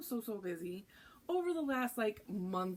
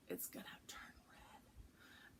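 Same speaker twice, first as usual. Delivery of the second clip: slow eerie whisper; spooky whisper; 19 dB quieter, slower than usual. Acted. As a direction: whisper it like a secret curse. Teasing the bleeding candle.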